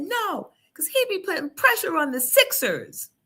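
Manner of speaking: high mock kid voice; son's voice. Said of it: "He said".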